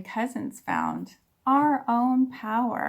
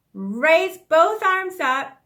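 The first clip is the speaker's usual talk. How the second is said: louder, higher, slow with a stress on both; rally the room, stretching every word; rousing call; 8 semitones higher, 9 dB louder, slower than usual. Shown as a demonstration. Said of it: "Join me, everybody".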